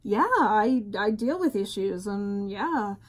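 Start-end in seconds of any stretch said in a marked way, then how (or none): none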